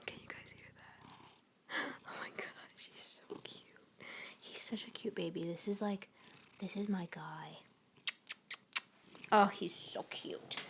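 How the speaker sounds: soft whisper so the purr is heard. Real, not acted. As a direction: whisper beside the purring cat. Whispering near purring cat.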